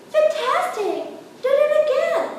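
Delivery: high, loud cheering call; excited shout. Acted. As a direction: egg the dragon on, shouting. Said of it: "but Elizabeth shouted".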